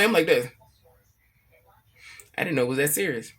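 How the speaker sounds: usual speaking voice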